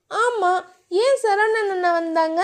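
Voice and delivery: put-on flustered young wife; shy heroine. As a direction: brush him off shyly, voice lifted. Dhiya voiced higher.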